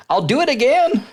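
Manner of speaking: mock a cheeky refusal to back down; Shallan. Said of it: "and then Shallan saying".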